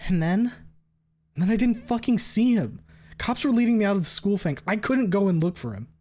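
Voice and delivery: high, loud, rushed explanation; agitated. Acted. The narrator explains to Fang in a high, loud rush. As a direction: blurt it out, frantic.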